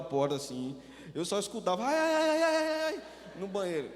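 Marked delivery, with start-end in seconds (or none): 1.8-3.0 high, loud retold wails of a boy being punished; child wailing. Pastor retells a boy's cries from the bathroom, high wailing. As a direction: wail high like a spanked child.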